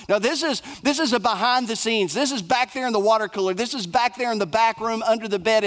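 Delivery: ordinary talk, nothing marked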